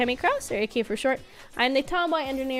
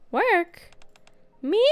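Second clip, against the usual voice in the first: put-on young girl voice; 7 semitones higher than usual. Girl surprised at offer.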